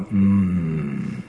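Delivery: a lightly voiced tiger pondering; tiger musing. Tiger muses over the dog.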